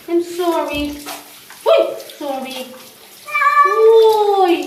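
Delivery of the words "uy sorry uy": long, loud, higher-pitched call; loud exclamation. Real, not acted. Bather to the meowing cat, drawn-out loud exclamation. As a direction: draw it out loud and high. Soothing a protesting cat in bath.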